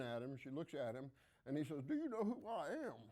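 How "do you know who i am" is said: much higher, self-important president asking; Bill Clinton impression. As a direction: puff up like a famous politician expecting recognition. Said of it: "That's my Bill Clinton impersonation".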